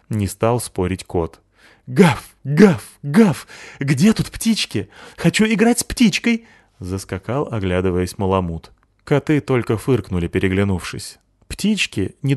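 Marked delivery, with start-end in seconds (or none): 1.9-6.5 high, loud, eager puppy; excited dog. Acted. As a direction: jump about looking for birds. Пёс ищет птичек. (восторженно, по-щенячьи)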